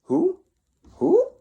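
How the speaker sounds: very high hooting who who; owl-like who, who in a bedtime story. Acted. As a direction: hoot like a small owl.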